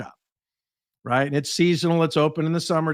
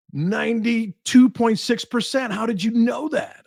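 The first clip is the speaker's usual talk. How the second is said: astonished; 7 semitones higher than usual. Retold or imitated. President stunned the consultant guessed his score, higher and amazed. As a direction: gasp it out in disbelief. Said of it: "he goes, gasps".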